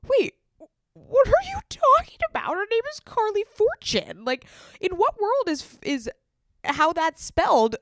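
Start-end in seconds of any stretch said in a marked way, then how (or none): 0.1-3.0 high, loud mock outrage; comic squawk of indignation; hammed-up incredulity. Acted incredulous argument with friend. 4.2-7.8 high, loud mock outrage; comic squawk of indignation; hammed-up incredulity. Acted incredulous argument with friend.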